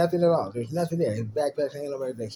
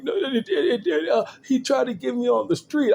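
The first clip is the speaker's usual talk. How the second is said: put-on whiny kid; 10 semitones higher than usual. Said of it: "You're like".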